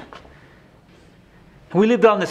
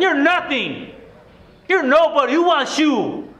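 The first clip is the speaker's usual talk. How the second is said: higher-pitched insults from a raging father; cussing father; 9 semitones higher than usual. Retold or imitated. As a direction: spit each insult down at him. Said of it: "He started cussing me out".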